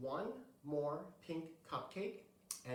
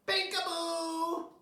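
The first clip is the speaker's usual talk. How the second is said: high, slow, loud call; yelling; 12 semitones higher, 7 dB louder, slower than usual. Acted. Little brother running in yelling. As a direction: yell it out gleefully. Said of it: "ran in and yelled".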